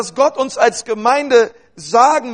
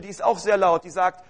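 normal talking voice

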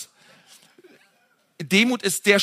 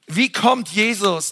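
ordinary talk, nothing marked

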